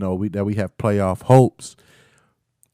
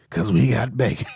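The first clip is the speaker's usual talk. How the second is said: teasing; 3 semitones higher than usual. Real, not acted. Teasing a Ravens fan friend.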